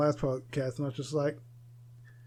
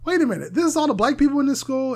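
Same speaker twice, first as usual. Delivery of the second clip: shocked classmate; 8 semitones higher, 6 dB louder than usual. Retold or imitated. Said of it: "someone stood up and said".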